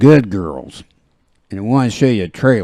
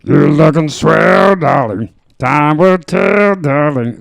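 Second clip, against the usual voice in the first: slow, higher crooning Satchmo voice; [name] impression; 6 semitones higher, 4 dB louder, slower than usual. Host imitates [name] singing to viewers, slow crooning. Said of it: "here's my impression of Louis, [name]".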